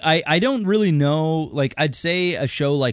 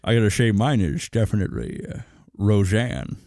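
Sean Connery voice; 4 semitones lower than usual. Acted. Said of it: "best Sean Connery impersonation".

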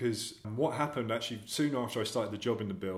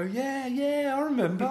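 high, bright fake agreement with a laugh; mock playing along; 6 dB louder than usual. Actor faking he remembers a fan from school. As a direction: pretend to remember, over-eager. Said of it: "I'd go".

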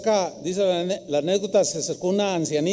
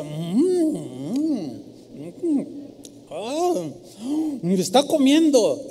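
savoring hums; 3 semitones higher, much slower than usual. Retold or imitated. Old woman savoring orange.

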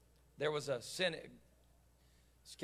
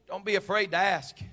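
ordinary talk, nothing marked

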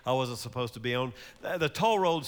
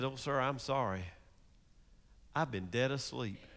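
sleepy apology; 3 semitones lower, 7 dB quieter than usual. Acted. Toll keeper just woke up.

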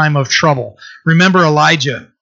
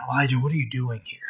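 hushed, quick and much softer, lower-edged murmur; whispering; 3 semitones lower, 11 dB quieter, faster than usual. Retold or imitated. Preacher voices the Lord whispering to Elijah in the cave, hushed. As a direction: drop right down to a near-whisper, imitating God's whisper. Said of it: "that the Lord whispers".

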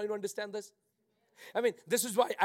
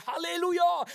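high, sustained mock praise cry; imitated praise singer; 11 semitones higher, 3 dB louder than usual. Preacher mimicking the first praise song, high praise cry. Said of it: "The first praise song".

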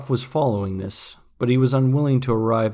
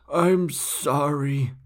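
very slow, lightly voiced old man; dying old man's apology; 4 semitones higher, much slower than usual. A narrator's reading. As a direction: stretch it out weakly.

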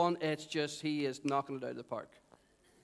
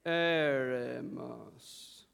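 "Eremos" extremely drawn out, low, on one note; slow monotone; 5 semitones lower, much slower than usual. Shown as a demonstration. Preacher leading the congregation to sound intelligent, slow monotone. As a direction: intone "Eremos" very slowly, like a holy chant. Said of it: "allow your voice to become monotone".

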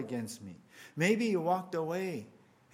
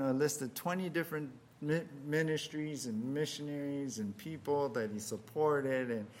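ordinary delivery

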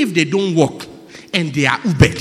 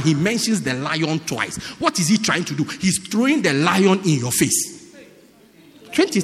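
ordinary delivery

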